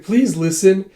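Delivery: mildly exasperated acted plea; pleading. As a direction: plead to be heard. Girl pleads with him.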